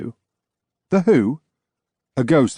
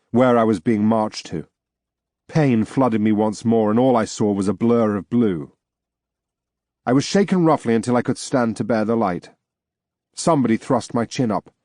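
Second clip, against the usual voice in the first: usual speaking voice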